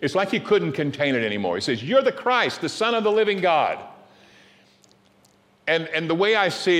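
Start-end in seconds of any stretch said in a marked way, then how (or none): 1.8-3.8 higher and louder, bursting out; lifted-up proclamation. Retold or imitated. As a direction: proclaim it like he can't hold it in. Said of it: "he lifts up his voice".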